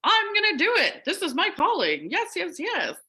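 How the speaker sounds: mock-triumphant ego cheering itself on; excited ego voice. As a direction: hype yourself up like a proud ego. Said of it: "my ego was like".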